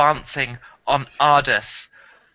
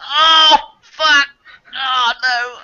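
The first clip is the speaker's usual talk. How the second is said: loud, high mock wail; comic high-pitched yell of dismay; retold cry of alarm; 8 semitones higher, 8 dB louder, much slower than usual. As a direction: re-enact a pained shout, hammed up. Retelling being stuck on the toilet.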